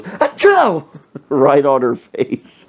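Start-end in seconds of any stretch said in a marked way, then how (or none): 0.0-0.8 high, loud achoo; mock sneeze. Friend sneezing in waitress's face, loud mock sneeze. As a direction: explode into a sudden fake sneeze. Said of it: "he just turns and goes".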